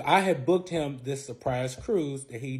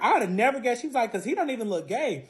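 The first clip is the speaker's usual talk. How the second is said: put-on surprised girl; 10 semitones higher than usual. Girl reacts to fiance news.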